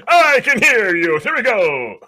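high, loud hero call; hammed-up heroic impression; anime hero voice. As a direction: call it out slowly like a cartoon hero. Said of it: "I can do it kind of well".

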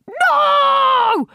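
high, loud bratty yell; bratty shout. Shown as a demonstration. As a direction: yell it like a furious kid. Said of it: "give him a".

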